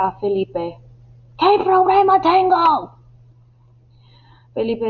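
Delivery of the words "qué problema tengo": high, loud, exasperated yell; frustrated shout. Acted. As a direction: shout the question at him in frustration. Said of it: "está muy frustrado y le grita". (grito de frustración)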